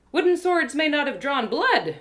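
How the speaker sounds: usual speaking voice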